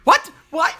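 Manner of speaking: high, loud disbelief; can't believe what she's hearing; incredulous. Real, not acted. Friend reacts to parents taking random turkeys, loud disbelief.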